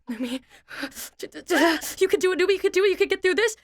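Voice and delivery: quick, high, pumped-up pep talk to herself; self pep talk. Retold or imitated. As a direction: psych yourself up in a rush. Self pep talk while flustered.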